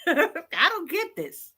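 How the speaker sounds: slow words half swallowed by a laugh; laughing in disbelief. Real, not acted. Laughing at her followers, exasperated, in disbelief.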